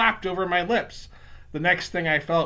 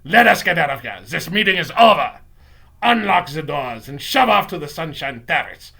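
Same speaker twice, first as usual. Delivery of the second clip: put-on loud accented witch command; imperious villain witch shout; Grand High Witch shouting; 9 dB louder than usual. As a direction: bellow orders to the whole meeting. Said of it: "the Grand High Witch was shouting".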